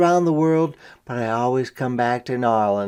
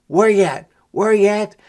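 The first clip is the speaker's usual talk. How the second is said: calling out; 5 semitones higher, 5 dB louder than usual. Acted. Leading a parade, calling out.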